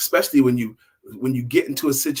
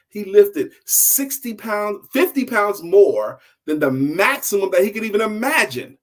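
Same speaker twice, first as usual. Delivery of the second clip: excited reveal; 7 semitones higher, 5 dB louder than usual. A narrator's reading. Storyteller reveals the surprising lift to his listeners, excited. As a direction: build to the triumphant payoff of the story.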